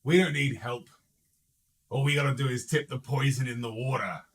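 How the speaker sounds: boisterous henchman calling out; piping up. Acted. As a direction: pipe up loudly from the back of the gang. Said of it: "pipes up and says".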